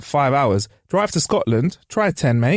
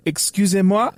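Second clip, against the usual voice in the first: higher, put-on French accent; mock French accent; 4 semitones higher than usual. Mate who can't speak French trying it on, mock French accent. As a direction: imitate a bluffer's French accent. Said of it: "try and speak English in the accent".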